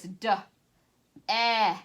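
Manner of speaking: d e k said sound by sound. Shown as a demonstration. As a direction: stretch each sound apart. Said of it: "now this word is".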